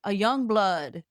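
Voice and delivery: usual speaking voice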